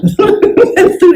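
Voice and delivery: sudden high-pitched laugh; real laugh. Real, not acted. Speaker caught drinking while presenting, amused laugh. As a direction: burst out laughing mid-talk.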